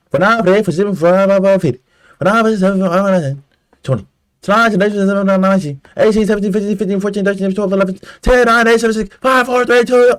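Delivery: high, loud barked countdown; drill countdown shout. Retold or imitated. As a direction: bark the numbers like a DI.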